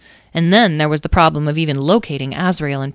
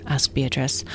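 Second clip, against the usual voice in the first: ordinary delivery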